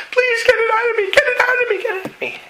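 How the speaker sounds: put-on very high pleading child's cry; imitating a child crying out, fading; little boy crying out. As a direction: beg like a frightened little boy. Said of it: "a little boy started crying out".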